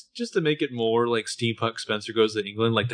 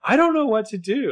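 high, louder helpless cry; despairing; 10 semitones higher, 5 dB louder than usual. Retold or imitated. Spencer despairing.

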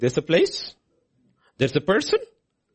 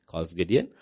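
normal talking voice

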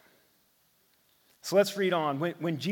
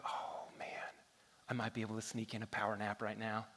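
whispered, much softer, hoping nobody hears; sneaky whisper; 7 semitones lower, 15 dB quieter, faster than usual. Retold or imitated. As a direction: hush it like sneaking a nap. Sneaking a nap in empty house.

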